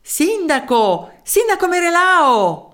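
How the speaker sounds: high, drawn-out hail; calling out. A narrator's reading. Townsman calls the mayor from an alley, high long shout.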